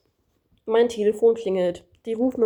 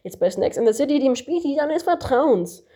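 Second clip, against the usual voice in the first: higher, pushy commercial pitchman; disguised ad voice; 5 semitones higher than usual. Acted. Kangaroo interrupting the narrator with a fake phone ad, higher pitchman voice. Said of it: "unterbricht das Känguru mit verstellter Stimme".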